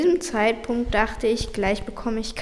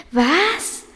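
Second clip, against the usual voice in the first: stunned outburst at the judge; shocked; 4 dB louder, much slower than usual. A narrator's reading. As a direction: blurt it out in disbelief. Shocked narrator reacts.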